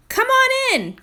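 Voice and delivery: big, high and loud cheerful greeting; hearty welcome. Acted. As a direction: call them in like a jolly fire captain. Captain welcomes kids to firehouse.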